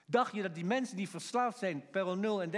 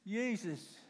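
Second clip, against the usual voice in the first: voice failing. Acted. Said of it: "zijn stem niet eens echt de toon had".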